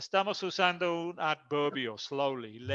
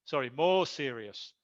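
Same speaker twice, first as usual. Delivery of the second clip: usual speaking voice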